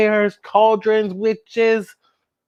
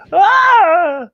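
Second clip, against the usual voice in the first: loud high frightened scream; scared scream; 11 semitones higher, 7 dB louder than usual. Acted. Storyteller screaming as the closet opens, loud and high. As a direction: shriek at the monster.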